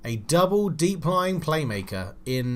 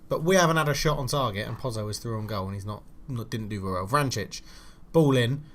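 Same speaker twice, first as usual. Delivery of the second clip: ordinary talk, nothing marked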